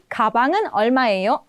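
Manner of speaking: slow, careful, each syllable clear; formal with particle. Shown as a demonstration. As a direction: spell out the particle 은 slowly. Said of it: "harus belajar sesuai dengan yang formal".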